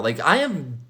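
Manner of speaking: ordinary talk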